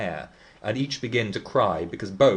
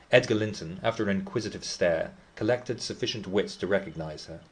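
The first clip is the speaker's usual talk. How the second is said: ordinary delivery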